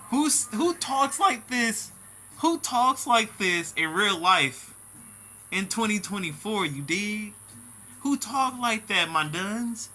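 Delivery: loud, high-pitched disbelief; incredulous outburst. Real, not acted. Reacting to the post's odd wording, loudly incredulous. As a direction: call it out high and loud, drawing out the question.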